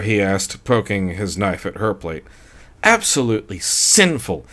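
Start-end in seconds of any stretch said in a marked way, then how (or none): 2.8-4.4 tipsy wife flirting theatrically with the waiter; theatrical enunciation. A narrator's reading. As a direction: savor each word for show. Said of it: "enunciated in that theatrical way".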